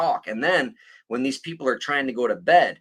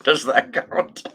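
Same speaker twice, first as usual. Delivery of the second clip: ordinary talk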